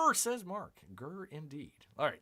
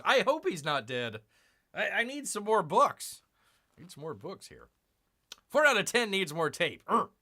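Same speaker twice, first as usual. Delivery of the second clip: ordinary talk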